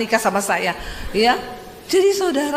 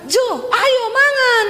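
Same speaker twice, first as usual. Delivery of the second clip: high Sulawesi tone; 13 semitones higher, slower than usual. Retold or imitated. Wife calls husband to eat, high Sulawesi tone. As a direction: call out high in Sulawesi style. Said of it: "selalu nadanya tuh tinggi di belakang".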